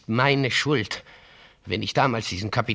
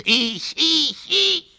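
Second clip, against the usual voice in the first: much higher, rising cry of guilt; anguished self-blame; slower than usual. Acted. Father blaming himself before his son, anguished cry. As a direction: cry out your own guilt.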